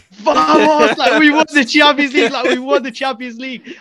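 high, loud triumphant cry; elated retold cheer, voice way up; jubilant celebration. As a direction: relive the winning moment, yelling with joy. Acting out the winning goal celebration.

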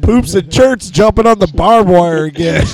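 lightly voiced rural old man; old husband calling out. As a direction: call to the wife across the yard.

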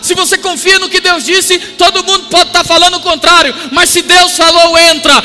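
high, loud, driving sermon voice; impassioned preacher at the peak of a sermon; full-force preaching. Real, not acted. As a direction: press every phrase on the congregation at a near shout.

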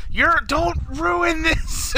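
very high, drawn-out mock shriek; Sarah yelling. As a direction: wail it slowly, way up high. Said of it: "she's just yelling at him".

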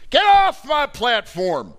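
very loud, high, slow shouted command; roaring get off my platform; furious shout. Acted. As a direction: bellow it at the crowd. Preacher shouts the domineering voice.

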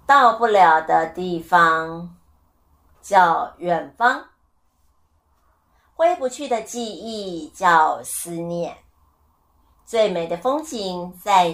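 slow, measured recital with long pauses. Shown as a demonstration. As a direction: lay each line out slowly, like reciting a poem. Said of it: "我们来复习一下咯". (放慢速度逐句朗诵)

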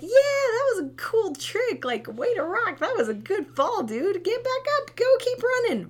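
high, loud, excited cheering; cheering parent. Shown as a demonstration. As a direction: cheer the toddler on like it was a stunt. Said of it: "Or like".

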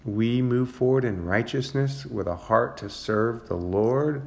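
ordinary delivery

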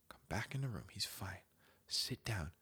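soft sneaking whisper; quiet whisper after leaving the kid's room. Retold or imitated. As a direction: tiptoe out, whisper it.

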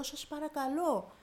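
acting out a drawn-out entreaty; retold tearful plea. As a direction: stretch out a mock tearful plea. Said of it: "Να κλαίω και να λέω".

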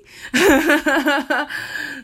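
big burst of laughter; loud laugh. Real, not acted. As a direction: laugh out loud, high and bright. Laughing at own aside.

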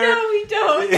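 loud, high laughing retort. Real, not acted. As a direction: fire back while laughing.

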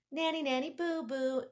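high, loud playground teasing; mock like a kid in a schoolyard; silly taunt. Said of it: "like a silly".

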